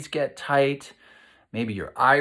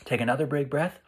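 guided breathing. Acted. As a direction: guide the kids through it calmly. Leads calming body exercise.

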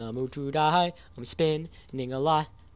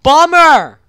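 shouted high bummer; loud bummer; 13 semitones higher, 21 dB louder than usual. Real, not acted. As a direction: yell out the disappointment. Shouted disappointment.

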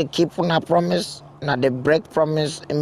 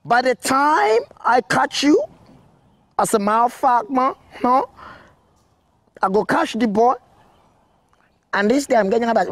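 furious threat; 8 semitones higher than usual. Acted. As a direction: rage until the words break. Kidnapper threatening to kill boy.

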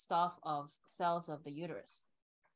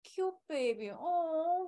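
tender cooing parent; 8 semitones higher, 4 dB louder, much slower than usual. Acted. Loving parent comforting a crying baby, cooing. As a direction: coo lovingly over the baby.

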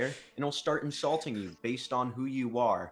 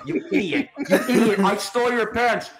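higher, louder mocking yell with laughs; yelling tape taunt; 8 semitones higher, 6 dB louder than usual. Cursed tape insulting Arthur, mock yelling. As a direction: crow the insults, half laughing. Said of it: "One last man put in the tape".

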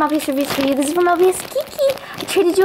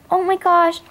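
ordinary talk, nothing marked

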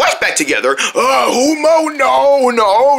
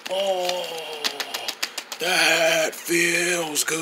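soft, very slow, drawn-out relief; blissful massage moan; 3 semitones lower, 10 dB quieter, much slower than usual. Pretend. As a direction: melt into it, stretching the words. King enjoying throat massage.

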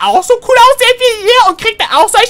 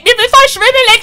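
normal talking voice

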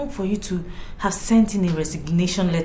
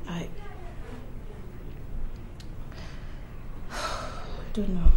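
despairing sigh; 8 dB quieter, much slower than usual. Acted. Wife despairs, sighs.

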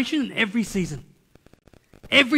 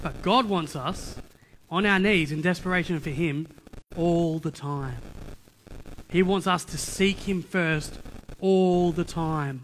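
slow, lowered preacher's voice; solemn preaching; 4 semitones lower, 3 dB quieter, slower than usual. Acted. Preacher urging people to seek God all the time, slow and solemn. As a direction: draw each phrase out gravely, with pauses.

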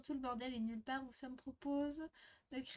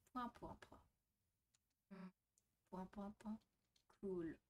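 extremely deep bass voice; 7 dB quieter, slower than usual. Acted. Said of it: "ma voix la plus grave possible".

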